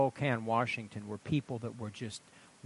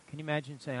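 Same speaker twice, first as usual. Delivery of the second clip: normal talking voice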